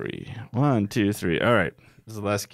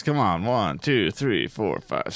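ordinary talk, nothing marked

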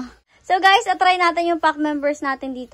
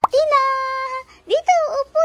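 calling out; 9 semitones higher, faster than usual. Real, not acted. Calling dog to sit.

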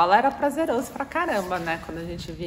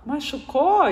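fake concern; 7 semitones higher, 4 dB louder than usual. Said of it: "Você fala".

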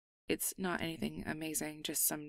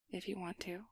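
softer, shy whisper; 8 dB quieter than usual. Acted. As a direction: give in quietly. Shy acceptance of hug.

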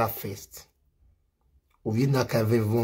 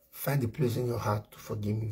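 quiet plea; 4 semitones lower, 10 dB quieter than usual. Real, not acted.

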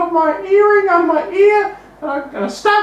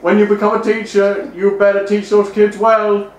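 deep, pompous voice lecturing on lessons; stuffy moralizing voice; 12 semitones lower than usual. Acted. Host questioning the guest, in a deep, pompous put-on voice. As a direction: talk down like a prim old schoolmaster.

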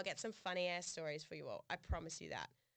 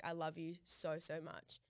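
retold genuine; 4 semitones lower, slower than usual. Quoting ex's "I love you" to co-host, sincere. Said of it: "real genuine tone".

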